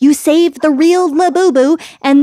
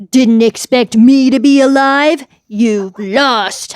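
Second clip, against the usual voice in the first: slow, gloating boss voice; villain taunt; slower than usual. Acted. Boss gloating at Ollie who survived, slow villain voice. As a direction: sneer it like a cartoon villain.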